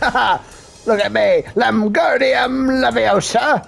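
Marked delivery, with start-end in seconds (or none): 1.5-3.6 mock Harry Potter spellcasting. Player doing some Harry Potter shit, hammed-up spell chanting. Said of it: "let me do some Harry Potter shit".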